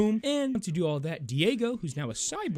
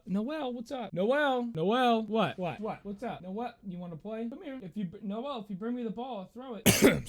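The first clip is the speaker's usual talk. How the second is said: talking to the cat; 9 semitones higher than usual. Real, not acted. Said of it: "Sorry, that was my cat".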